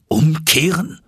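high, incredulous exclamation; indignant cry. A narrator's reading. Uncle hears nephew wants to return, indignant cry. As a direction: throw it back as an outraged question. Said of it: "rief mein Onkel".

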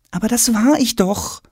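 put-on high-pitched boy's protest. A narrator's reading. Tom insists to father he stayed home, protesting.

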